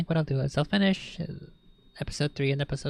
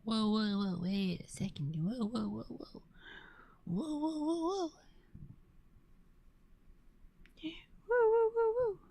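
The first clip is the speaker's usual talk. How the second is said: rising alarmed whoas; 6 semitones higher than usual. Real, not acted. Cat suddenly jumps around.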